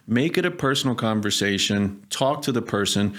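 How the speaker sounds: normal talking voice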